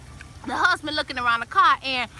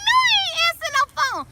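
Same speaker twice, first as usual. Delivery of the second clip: much higher, louder outraged no; squealing refusal; 14 semitones higher, 3 dB louder than usual. Retold or imitated. Refusing to answer the friend's phone, high squealing outrage. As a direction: shriek the refusal in disbelief. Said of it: "I'm like".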